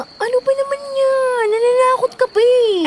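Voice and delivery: high, whimpering complaint; half-crying protest at being frightened; scared whine. Acted. Girl spooked by yaya's aswang talk, whimpering high protest.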